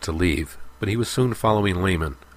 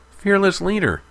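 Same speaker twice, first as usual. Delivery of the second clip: much higher and louder, a mocking jab; joking; 14 semitones higher, 6 dB louder than usual. A narrator's reading. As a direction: tease him with a lofty title. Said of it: "joked Lehman".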